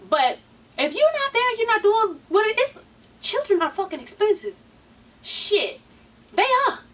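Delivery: high emphatic rant. Real, not acted. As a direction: push it up high, insistent.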